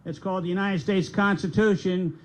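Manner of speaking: ordinary delivery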